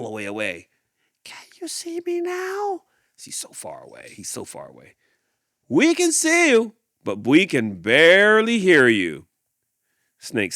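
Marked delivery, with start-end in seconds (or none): 1.2-2.8 tiny distant voice. Acted. Giraffe far away. 3.2-5.0 hushed aside. A narrator's reading. Narrator on distance. 5.7-9.3 calling out. Acted. Animals call to far giraffe.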